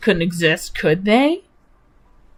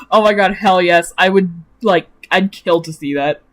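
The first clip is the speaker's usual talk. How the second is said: ordinary talk, nothing marked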